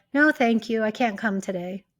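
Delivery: calm, softening polite refusal; calm and neutral. Shown as a demonstration. Coach demonstrating a clean no to an invitation, calm and neutral. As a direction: let it down gently and evenly. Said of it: "calm and neutral, not defensive or aggressive".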